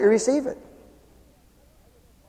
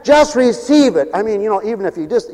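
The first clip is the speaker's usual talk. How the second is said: ordinary delivery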